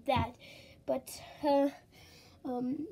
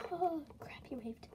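alarmed whisper; 10 dB quieter than usual. Acted. Spotted by the neighbor.

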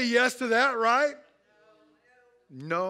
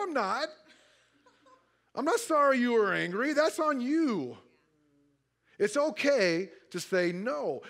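usual speaking voice

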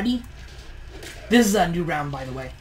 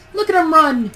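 excited shout; 9 semitones higher, 9 dB louder than usual. Real, not acted. Enemy fleeing in battle.

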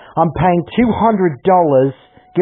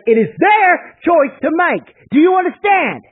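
furious lecture; 11 semitones higher than usual. Real, not acted. Lecturing the parents in anger.

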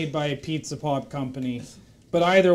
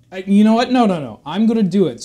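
loud burst of determination, a bit higher; sudden resolve; 4 semitones higher, 8 dB louder, faster than usual. Acted. Horse deciding to turn his life around, loud and determined. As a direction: snap out of the slump and commit out loud.

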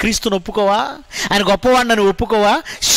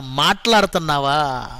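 normal talking voice

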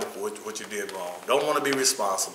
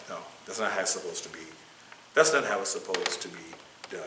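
ordinary delivery

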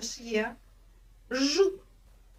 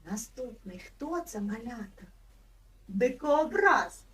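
normal talking voice

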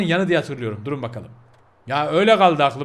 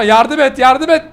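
loud, high frantic call for help; panicked plea; 6 semitones higher, 7 dB louder, slower than usual. Real, not acted. Begging Michael for help. (panik içinde yardım isteyen ses)

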